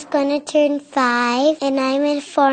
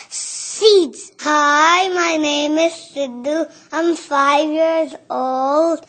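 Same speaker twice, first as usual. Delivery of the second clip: usual speaking voice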